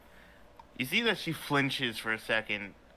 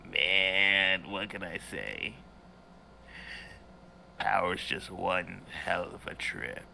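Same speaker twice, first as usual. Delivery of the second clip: Scuzzy; 4 semitones lower than usual. Acted. Villain appearing behind the players, low and gloating. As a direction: gloat in a lowered voice. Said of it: "and you see Scuzzy".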